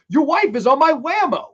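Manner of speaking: high, loud comeback; mock indignant retort. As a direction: yell it back, acting out the quarrel. Shouted reply in acted quarrel.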